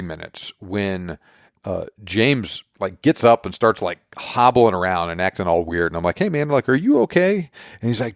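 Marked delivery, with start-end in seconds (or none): 6.2-7.5 pitched high, startled concern; worried check-in. Retold or imitated. Dad startled, asking his son if he's okay. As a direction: ask suddenly, alarmed.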